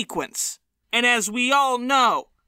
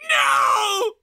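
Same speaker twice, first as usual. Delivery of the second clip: much higher, louder no; mock outraged shout; 11 semitones higher, 4 dB louder than usual. Fan rejecting Mulaney's retort, mock outraged shout. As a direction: yell it in outrage. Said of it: "they'll be like".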